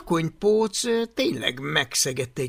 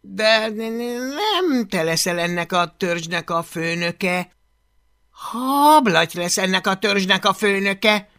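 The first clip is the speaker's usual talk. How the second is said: shaky stutter, then louder; stammering nervous friend; 5 semitones higher, 5 dB louder than usual. Acted. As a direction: force the words out. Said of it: "sikerült kinyögnie pár szót". (dadogva, ijedten)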